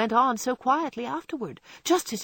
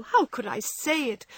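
higher anguished question; tearful cry; 5 semitones higher than usual. A narrator's reading. Meg reproaching herself to John, anguished and tearful. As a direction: cry it out in remorse. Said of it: "crying with repentant tears".

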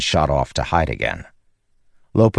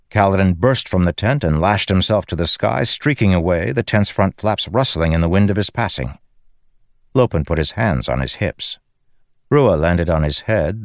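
plain reading voice

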